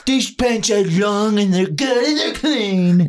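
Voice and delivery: slow high businessman voice; Trump impression. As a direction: brag about pants like Trump. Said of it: "sounds like Trump".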